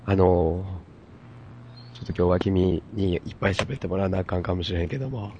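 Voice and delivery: hoarse, stuffy voice from a cold; nasal cold voice. Real, not acted. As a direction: talk through a blocked nose. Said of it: "すごい鼻声".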